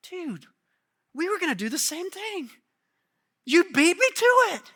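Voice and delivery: disciples griping that they were beaten to it; jealous disciples. Retold or imitated. Disciples complaining to James and John, high and jealous. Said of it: "Here's what they're indignant about".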